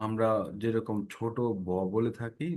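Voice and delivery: ordinary delivery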